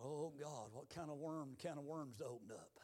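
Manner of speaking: very soft, low, dismayed aside; hushed inner thought. Retold or imitated. Preacher recalling his dread as Levi dropped his head, hushed thought. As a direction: think it aloud under the breath.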